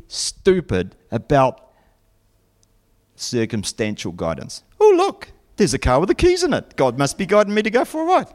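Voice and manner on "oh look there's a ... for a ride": high, quick mock-naive excitement; mock wide-eyed discovery; naive believer. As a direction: gush as if you just spotted a sign. Mock naive believer misreading signs.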